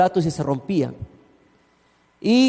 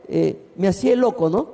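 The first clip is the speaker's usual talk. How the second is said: ordinary talk, nothing marked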